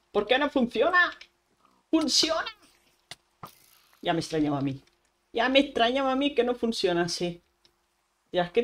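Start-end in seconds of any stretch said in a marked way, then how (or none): 0.1-2.5 higher-pitched, baffled complaint; exasperated protest. Real, not acted. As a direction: protest at gear that won't work. Complains that the weapon fails.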